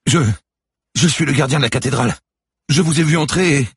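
high, loud, frightened gabble; panicked explanation. A narrator's reading. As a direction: explain yourself fast, pinned and terrified. Pinned guard stammers explanation. (explication affolée, voix aiguë)

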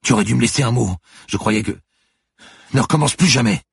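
angry scolding. Acted. Manon scolding Turin after scare.

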